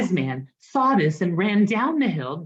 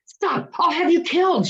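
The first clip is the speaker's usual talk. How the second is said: high, loud royal threat; a king shouting in outrage; furious shout; 12 semitones higher, 4 dB louder than usual. Acted. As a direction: yell the death threat at him. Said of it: "shouted the king".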